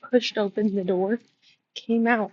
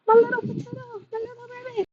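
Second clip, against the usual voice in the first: cooing far higher at her little dog. Real, not acted. Cooing baby talk about her frightened little dog.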